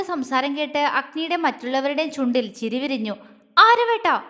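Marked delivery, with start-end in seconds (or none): 3.6-4.2 sharp, much higher and louder call of his name; angry call. Acted. Soubi angrily calls out to him at the table, sharp and loud. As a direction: snap his name out in anger. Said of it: "ദേഷ്യത്തോടെ വിളിച്ചു".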